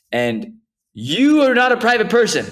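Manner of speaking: high, loud teasing protest; loud, high playful accusation; excited protest. Real, not acted. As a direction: call him out, laughing indignation. Teasing friend, mock indignant.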